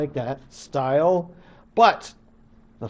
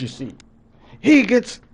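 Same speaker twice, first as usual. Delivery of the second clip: usual speaking voice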